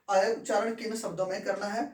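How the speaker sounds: normal talking voice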